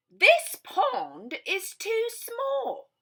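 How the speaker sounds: high, slow fussy voice; storybook visitor. Acted. Storybook visitor fussing about the rain, high and slow. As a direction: stretch out the complaint.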